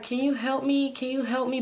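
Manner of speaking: lightly voiced older man, seeming lost; imitating confused man. Older stranger asking a woman in her car for help, confused. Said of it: "he's talking like he can't really comprehend".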